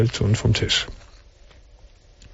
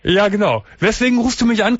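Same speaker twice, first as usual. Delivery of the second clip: pitched much higher to carry; speaking up; 9 semitones higher than usual. Real, not acted. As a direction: project up for the caller. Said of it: "Du musst schon ein bisschen lauter reden".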